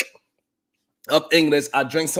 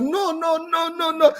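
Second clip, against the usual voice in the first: high no no no; 14 semitones higher than usual. Retold or imitated. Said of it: "she's like".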